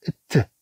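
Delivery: الت ending in a bare light t. Shown as a demonstration. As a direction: close الت on a released t. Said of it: "الطي أو تنطق الت".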